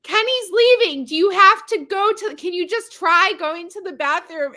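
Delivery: high, loud pleading call; urging relative. Retold or imitated. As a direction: call out, hurrying an old uncle. Family urging Seymour, retold.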